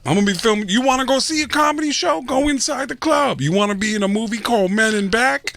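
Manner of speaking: high, pushy hustler pitch; sketchy street hustler. Retold or imitated. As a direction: lure them in like a shady promoter. Guy pitching a fake movie.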